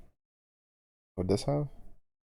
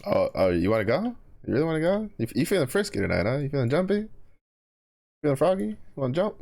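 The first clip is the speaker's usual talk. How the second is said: taunting; 9 semitones higher than usual. Real, not acted. Taunting a game opponent.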